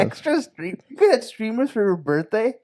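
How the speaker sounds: high-pitched amazed question; incredulous. Real, not acted. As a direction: question it in rising disbelief. Incredulous question about birthday streamers.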